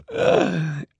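high affected laugh; a vain little society laugh; snooty trill laugh. Acted. Esme laughs.

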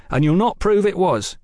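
defiant, firm statement; emphatic, challenging denial; flat denial. A narrator's reading. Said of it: "Velasco stated flatly".

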